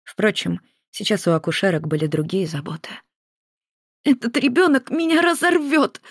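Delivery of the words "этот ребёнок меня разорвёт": high, wailing sob in labour pain; a higher, tearful wail; sobbing cry. A narrator's reading. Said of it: "рыдала Маргарита".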